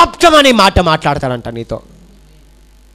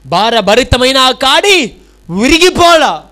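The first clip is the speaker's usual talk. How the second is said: louder, higher and slow, each word weighted; emphatic repeat; 7 semitones higher, 3 dB louder, slower than usual. Shown as a demonstration. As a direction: hammer the line home to the congregation. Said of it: "ఏమంటున్నాడు?". (గట్టిగా, నెమ్మదిగా నొక్కి చెప్పడం)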